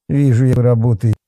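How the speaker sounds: disgruntled snort. A narrator's reading. Policeman doubts the campers' work, disgruntled snort. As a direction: snort with displeasure. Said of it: "недовольно фыркнул Валентин".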